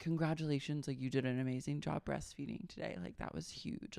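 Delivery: soft, fast, hushed sarcasm; mock patronizing praise. Host mocking what husbands expect, softly sarcastic. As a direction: coo it like praising a child. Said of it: "be like".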